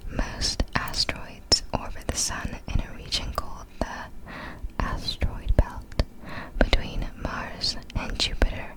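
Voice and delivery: usual speaking voice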